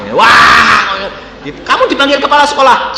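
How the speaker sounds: loud, high startled cry; shocked father raising his voice; alarmed exclamation. Retold or imitated. Father hearing son was summoned, loud and shocked.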